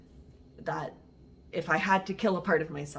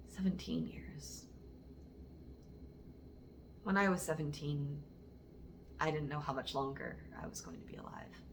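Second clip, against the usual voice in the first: very soft, falling almost to a whisper; hushed and somber; 2 semitones lower, 12 dB quieter than usual. Acted. Poet recalling not expecting to live past seventeen, hushed and somber.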